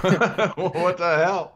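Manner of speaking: laughing in disbelief. Real, not acted. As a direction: laugh through the words. Laughing exclaim.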